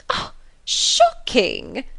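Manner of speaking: drawn-out mock horror, slow; a slow, affected "Ah, shocking!"; shocked cry. A narrator's reading. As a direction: gasp it out, scandalised. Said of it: "cried Miss Bingley".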